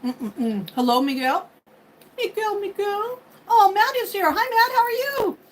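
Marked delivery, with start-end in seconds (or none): 2.2-3.2 singsong name calling. Real, not acted. Singsong greeting to a viewer. 3.5-5.4 excited high greeting. Real, not acted. Excited greeting of arriving viewer.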